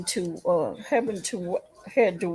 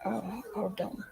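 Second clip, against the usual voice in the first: whisper; 12 dB quieter than usual. Real, not acted. Aside while busy off camera.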